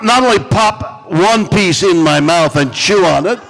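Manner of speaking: usual speaking voice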